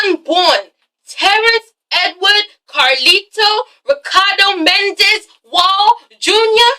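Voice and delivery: slow, high dramatic announcer voice; hammed-up talk-show announcement; mock paternity reveal. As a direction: draw it out like a TV verdict, building to a shout. Mock paternity-test results reveal.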